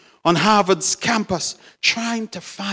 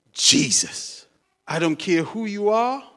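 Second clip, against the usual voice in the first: ordinary talk, nothing marked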